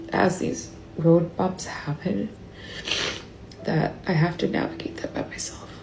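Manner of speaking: soft, halting grief; choked up. Real, not acted. Grieving over husband's coming death.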